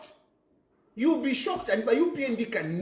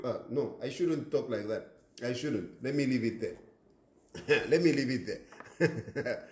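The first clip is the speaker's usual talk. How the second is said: self-correcting aside; 8 semitones lower, 5 dB quieter than usual. Real, not acted. Checks himself, drops voice.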